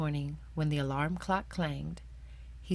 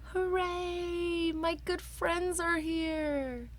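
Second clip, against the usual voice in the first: much higher, slow drawn-out joyful cheer; delighted cheer; 14 semitones higher, much slower than usual. Acted. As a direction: stretch it out with pure delight, pitched way up. Amos delighted his friends came.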